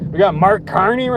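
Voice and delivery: ordinary delivery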